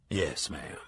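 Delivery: a whispered "Yes, ma'am"; hushed yes. Acted. Mar answers Lenora in a hushed whisper. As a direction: breathe it, barely voiced.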